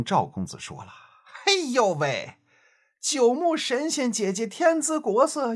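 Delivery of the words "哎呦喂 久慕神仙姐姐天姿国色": leering playboy voice. Acted. Narrator voices the flirting playboy.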